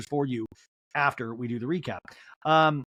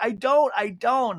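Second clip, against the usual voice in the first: high, louder wail; mock upset protest; 8 semitones higher, 4 dB louder, slower than usual. Castmate contradicting her comforting friend, high mock-upset. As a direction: imitate a distraught friend insisting.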